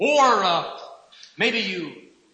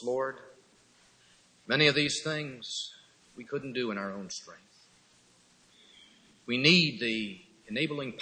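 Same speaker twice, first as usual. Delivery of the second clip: prayer; 4 semitones lower than usual. Acted. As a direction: pray humbly before the congregation. Closing prayer.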